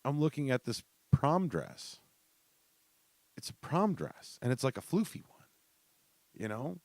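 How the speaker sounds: incredulous. Retold or imitated. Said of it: "And I'm like".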